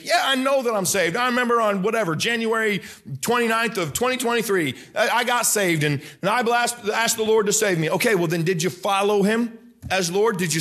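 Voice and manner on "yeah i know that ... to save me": smug believer. Retold or imitated. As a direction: play the self-assured churchgoer, pitched up. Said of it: "are you saved?".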